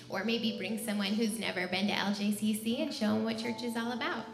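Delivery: ordinary talk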